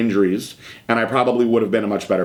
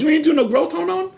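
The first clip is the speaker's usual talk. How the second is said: high-pitched retold disbelief; incredulous. Veteran bodybuilder questioning a younger man's cycle, incredulous. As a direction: imitate an astonished question, voice shooting right up.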